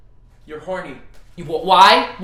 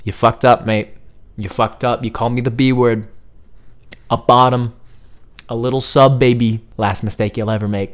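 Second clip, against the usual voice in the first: menacing; 11 semitones lower, 4 dB quieter than usual. Acted. [name] voiced menacing.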